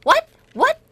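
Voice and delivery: high, abrupt irritated question; annoyed. Retold or imitated. American who didn't catch the learner's pronunciation, annoyed. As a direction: imitate a native impatiently demanding a repeat. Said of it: "짜증나게 좀 다시 얘기하라는 식으로".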